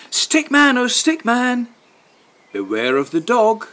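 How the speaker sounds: high sing-song warning chant; warning refrain. A narrator's reading. Storyteller chanting a warning to Stickman, sing-song.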